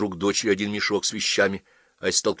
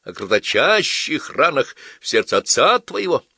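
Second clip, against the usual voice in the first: lightly voiced pompous father, higher and louder; drunken father orating; 5 semitones higher, 5 dB louder than usual. Father laments to his daughter, orating. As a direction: declaim it with mock grandeur.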